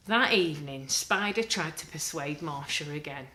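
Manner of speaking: ordinary delivery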